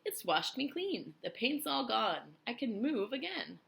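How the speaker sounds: high, slow, gleeful; excited centipede. A narrator's reading. Centipede freed of paint, high gleeful voice. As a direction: crow with delight. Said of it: "shouted the centipede excitedly".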